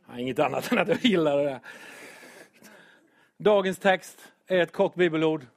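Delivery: normal talking voice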